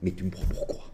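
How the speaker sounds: hushed whisper; wife whispering to husband in bed. Acted. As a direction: hiss it in the dark bed. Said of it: "elle se retourne et elle lui dit".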